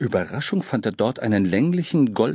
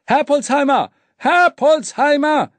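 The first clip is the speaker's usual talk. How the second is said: hoch und laut gebrüllt, langsam; brüllen; 7 dB louder than usual. A narrator's reading. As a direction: schrei es begeistert heraus. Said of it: "brüllte er". (laut gebrüllter Jubelruf)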